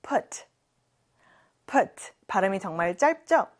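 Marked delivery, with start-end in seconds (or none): 0.0-2.1 clipped short "Put". Shown as a demonstration. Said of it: "같이 발음해 볼까요". (짧게 끊는 "Put" 발음)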